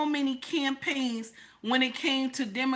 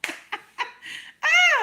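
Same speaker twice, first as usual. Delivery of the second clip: loud squealing laugh, much higher; high shriek of laughter; 6 dB louder than usual. Real, not acted. Embarrassed high squeal of laughter after her own story.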